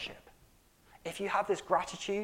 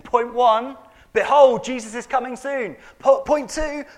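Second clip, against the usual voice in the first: loud, high, ringing proclamation; preaching at full force; 7 semitones higher, 14 dB louder than usual. Real, not acted. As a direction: proclaim it to the whole hall. Preacher building to a climax.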